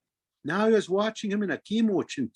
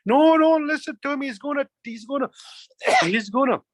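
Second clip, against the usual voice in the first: higher-pitched, jeering warning; mocking birds; 6 semitones higher, 4 dB louder than usual. Birds mocking him as they fly away, mocking. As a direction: warn the flock off him, voice raised. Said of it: "they'd all start mocking him, saying".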